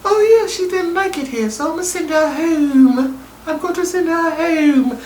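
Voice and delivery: high and eager to please; naive boy voice. Retold or imitated. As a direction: squeak it like a lovesick teen. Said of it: "And he's like".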